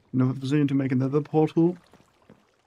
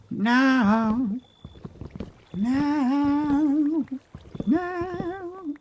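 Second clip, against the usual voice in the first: each no climbing much higher in pitch; rising squeal of dismay, climbing up; panicked rising no; 12 semitones higher, much slower than usual. Real, not acted. Watching something go wrong.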